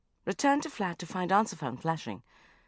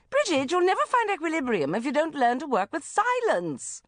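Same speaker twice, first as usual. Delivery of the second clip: preachy mother; 10 semitones higher, 5 dB louder than usual. Acted. Mother scolding from phone.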